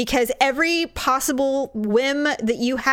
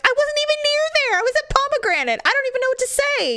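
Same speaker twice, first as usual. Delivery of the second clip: high, fast, indignant squeak; flustered guard protesting; faster than usual. Retold or imitated. Accused guard defending himself to management, high and fast. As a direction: protest your innocence in a rush.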